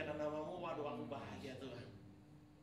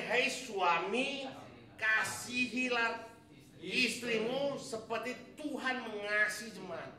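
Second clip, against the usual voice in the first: very slow, loud, higher proclamation; proclaimed scripture; 7 semitones higher, 10 dB louder, much slower than usual. Acted. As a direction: stretch each word out like a pulpit proclamation. Solemn scripture quote to husbands.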